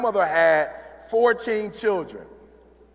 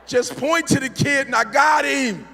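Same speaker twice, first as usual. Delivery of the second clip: high, slowing comic threat; mock menace, stretched at the end; protective dad; 7 semitones higher, 4 dB louder than usual. Dad asking daughter to point to the kid, hammed-up fierce. Dad vows to get the boy.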